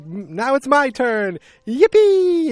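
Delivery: loud, high, stretched-out whoop; mock gleeful. Old man leaping off the cliff, mock gleeful. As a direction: build to a shrieked comic cheer.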